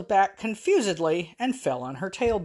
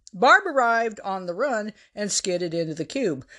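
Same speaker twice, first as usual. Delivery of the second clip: ordinary delivery, nothing marked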